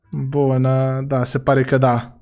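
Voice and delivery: ordinary talk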